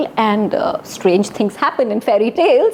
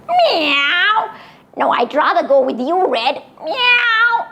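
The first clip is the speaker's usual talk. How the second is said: talking cat; 6 semitones higher, slower than usual. Acted. Cat agrees to follow.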